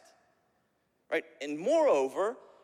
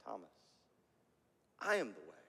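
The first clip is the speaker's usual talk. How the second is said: much lower and softer, falling away; hushed echo; 12 semitones lower, 11 dB quieter, much faster than usual. Acted. Preacher quietly letting Jesus' words sink in, hushed. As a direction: drop to a near hush on I am the way.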